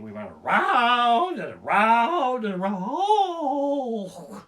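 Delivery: slow, high drawn-out boast; hammed-up singsong bragging; mock brag. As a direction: stretch it out like a proud tourist. Bragging Ferris rider.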